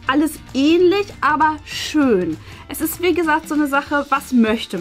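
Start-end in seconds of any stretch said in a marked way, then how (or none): none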